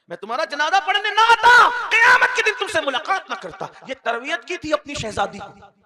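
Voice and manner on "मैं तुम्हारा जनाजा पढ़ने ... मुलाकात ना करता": loud, high, forceful declaration; stern loud warning. Acted. Prophet warning his daughter of the consequence, loud stern declaration.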